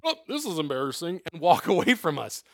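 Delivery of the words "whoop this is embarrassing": awkward Jesus. Retold or imitated. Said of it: "Christ is gonna be like".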